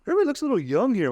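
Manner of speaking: high and fast, half-joking; imitating own nervous remark; mock high worried voice. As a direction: toss it off quick and high. Retelling nightclub moment.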